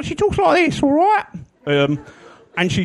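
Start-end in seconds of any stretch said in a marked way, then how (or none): 0.1-1.3 much higher, girlish impression of a colleague; little-girl voice. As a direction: mimic a producer who sounds like an eight-year-old. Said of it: "she has the voice of a kind of eight-year-old girl".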